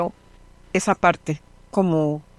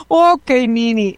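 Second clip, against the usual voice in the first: put-on higher, slower little girl voice; child agreeing; 5 semitones higher, 4 dB louder, slower than usual. A little girl agrees with her teacher Nini, higher and slow. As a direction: answer like a calmed small child.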